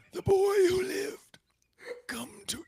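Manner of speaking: high hissed whisper of a dark lord; mock Voldemort line in a whisper. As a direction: breathe it out menacingly. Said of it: "a little bit of a British accent".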